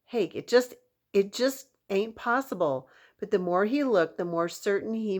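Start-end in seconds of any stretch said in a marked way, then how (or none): 0.0-2.9 disbelief. Acted. Cowboy disbelieving.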